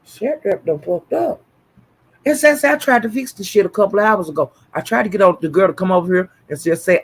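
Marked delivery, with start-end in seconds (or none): 0.1-1.4 retold dismay. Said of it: "I was saying".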